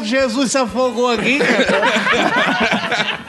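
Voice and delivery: put-on high, rising incredulous drunk, cracking into laughter; indignant drunk. Drunk asking the priest, high incredulous laugh. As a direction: sell the punchline as a baffled drunk.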